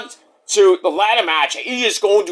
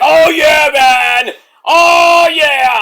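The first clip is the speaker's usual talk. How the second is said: loud, high hyped-up "oh yeah"; booming mock Booker T cheer; Booker T hype; 8 semitones higher, 7 dB louder, much slower than usual. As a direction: shout it like an excited commentator. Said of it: "Booker T's like".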